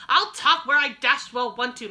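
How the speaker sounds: gruff defiance. Acted. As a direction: refuse him loudly, indignant. Angry father refuses to move.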